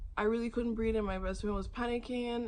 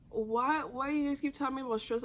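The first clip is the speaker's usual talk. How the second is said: higher-pitched, exasperated why; retold frustration, voice raised in pitch; exasperated protest; 6 semitones higher than usual. Patient fed up with doctors blaming stress, exasperated.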